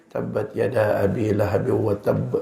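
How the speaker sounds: hushed, low, slow recitation; soft, deep, unhurried Quran recitation. Shown as a demonstration. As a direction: drop the voice right down out of reverence. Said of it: "Rendahkan suaranya".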